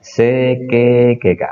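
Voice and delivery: C K G K, each letter spoken separately; spelled pronunciation. Shown as a demonstration. Teacher spelling out Seikei for students: letter by letter. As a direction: sound out Seikei as C K G K, with long E. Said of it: "itu diucapkan E panjang".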